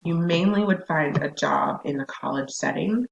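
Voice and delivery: normal talking voice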